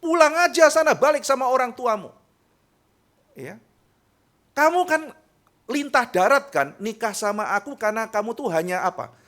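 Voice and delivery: high, loud contemptuous scolding; angry insult. Acted. Spouse contemptuously drives partner away, loud and scornful. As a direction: hurl the insult at your spouse.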